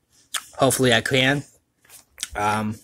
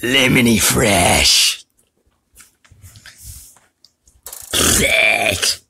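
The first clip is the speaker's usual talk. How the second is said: character voice; 5 dB louder, much slower than usual. Acted. Character voice lines.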